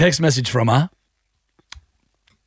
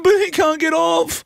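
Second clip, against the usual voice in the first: very high, pleading imitation of tearfulness; mock crying wife. Husband mimicking his wife over the stuck robot, mock weepy. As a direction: plead in a squeaky, about-to-cry voice. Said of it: "She's gonna cry".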